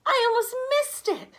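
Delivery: put-on a boy still thrilled by the rabbit; excited boy. A narrator's reading. Boy tells his friend about the rabbit, excited. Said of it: "Alan exclaimed".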